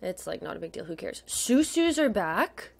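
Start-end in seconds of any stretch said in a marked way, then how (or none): 1.3-2.7 excited announcement. Real, not acted. Celebrating that the group is back.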